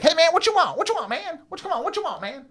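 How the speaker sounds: high, loud, fast street hustler; little hustler voice. Retold or imitated. Little scarred hustler pushing on the narrator. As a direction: squeak it out like a wiry little hustler. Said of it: "Like this, like a little".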